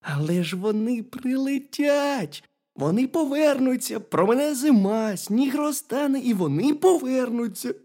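high-pitched hedgehog consoling a friend; reassuring hedgehog. A narrator's reading. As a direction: cheer up the bear in a high voice. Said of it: "Та заспокойся".

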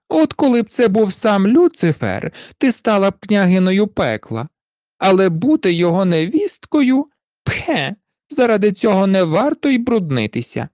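high, quick, scornful taunting; mocking. A narrator's reading. Elder sisters taunting the youngest about her bridegroom, mockingly. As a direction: needle her with high-pitched, cutting contempt. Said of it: "почали з неї глузувати і колючими словами".

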